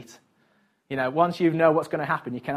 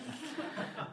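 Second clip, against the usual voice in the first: breathy giggle; 16 dB quieter than usual. Real, not acted. Laughter before quoting child.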